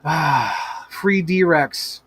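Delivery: ordinary talk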